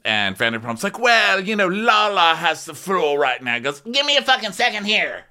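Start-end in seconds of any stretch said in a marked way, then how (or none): 1.0-3.5 higher, louder prim imitation; mock Vanderpump. Voicing Vanderpump in recap. 3.8-5.2 higher mock snap at the group. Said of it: "And snaps".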